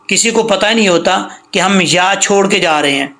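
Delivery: ordinary delivery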